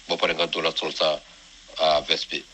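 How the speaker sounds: ordinary talk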